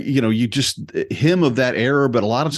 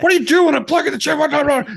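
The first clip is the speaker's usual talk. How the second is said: high mock-indignant squawk; outraged Red; faster than usual. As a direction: snap back to grumpy in a high yelp. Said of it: "He goes".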